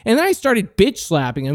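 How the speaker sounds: ordinary delivery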